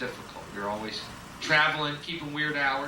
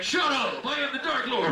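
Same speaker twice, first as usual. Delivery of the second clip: higher, louder indignant Satan; huffy Dark Lord; 10 semitones higher, 3 dB louder than usual. Retold or imitated. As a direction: insist on being feared, whiny. Satan asserting himself.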